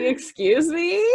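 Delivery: high, slow, laughing "Excuse me?"; mock offended. Retelling the LA guest, laughing mock offence, high and slow. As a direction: act scandalised through a laugh. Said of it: "It's like".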